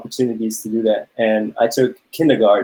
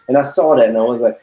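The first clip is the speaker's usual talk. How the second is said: ordinary talk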